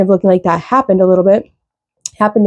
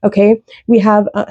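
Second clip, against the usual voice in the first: ordinary talk, nothing marked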